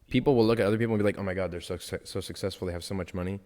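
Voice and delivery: ordinary talk, nothing marked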